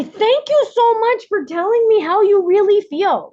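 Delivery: put-on high, gentle voice like talking to a small kid; sweet to a child. Shown as a demonstration. Speaker showing how to answer the inner child, high sweet coo. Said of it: "talk to her like she's a kid".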